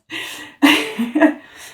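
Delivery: helpless little laugh; amused laugh. Real, not acted. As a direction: crack up for real. Amused by Nala's behind.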